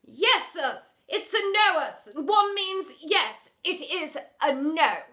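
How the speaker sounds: loud, high exasperated. Acted. Headmistress losing patience, loud and high. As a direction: spell it out in fury.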